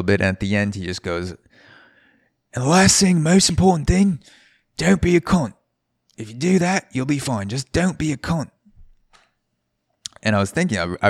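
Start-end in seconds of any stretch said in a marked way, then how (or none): 2.5-8.5 bad Australian accent, landing the final rule; bartender's closing rule in a mock Australian accent. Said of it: "Whatever terrible accent you appropriate".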